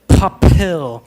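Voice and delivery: "papel" with a strong puff of air on each p, loud; aspirated p. Shown as a demonstration. Teacher demonstrates the English puff of air in "papel". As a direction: blow hard on the p's of "papel" like an English speaker. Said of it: "pueden escuchar en el micrófono que me está saliendo mucho aire".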